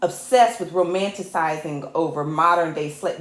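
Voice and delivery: ordinary talk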